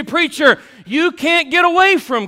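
high, loud mock congregant protest; hammed-up churchgoer retort; voicing the congregation. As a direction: shout back as the doubting listener. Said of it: "You say".

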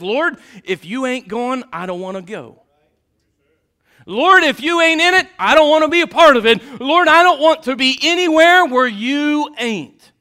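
fervent Moses crying out to the Lord; Moses pleading with God. Retold or imitated. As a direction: beg the Lord not to leave you. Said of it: "Moses that says".

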